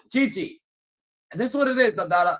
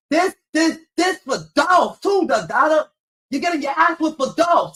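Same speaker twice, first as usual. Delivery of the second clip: angry shout; 7 semitones higher, 4 dB louder than usual. Retold or imitated. Dad shouting back at his crying daughter, furious yell. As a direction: roar it back over her crying. Dad keeps whipping kid.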